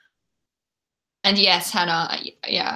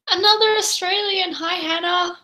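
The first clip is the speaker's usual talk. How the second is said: much higher, squealing with delight; thrilled greeting; 11 semitones higher than usual. Real, not acted. Excitedly welcoming a fellow Australian in the chat, much higher. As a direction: greet her like a long-lost friend.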